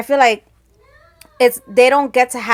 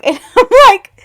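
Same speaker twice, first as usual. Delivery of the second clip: laughing; 11 semitones higher, 13 dB louder than usual. Real, not acted. Laughing while retelling the live video.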